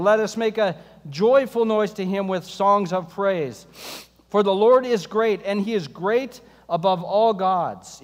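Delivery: loud, ringing psalm reading to the church; scripture reading. Acted. Pastor reading Psalm ninety-five aloud to the congregation, ringing and full. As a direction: proclaim the psalm to the whole room.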